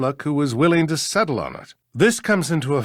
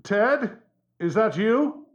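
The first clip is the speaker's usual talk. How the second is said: high, raised voice through a door; calling through door; 7 semitones higher, slower than usual. Acted. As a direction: call out uncertainly to whoever is outside. Calls through a locked office door.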